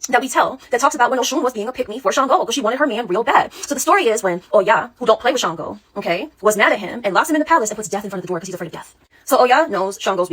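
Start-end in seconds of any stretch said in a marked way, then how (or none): none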